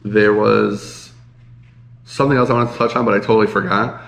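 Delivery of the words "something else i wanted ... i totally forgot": usual speaking voice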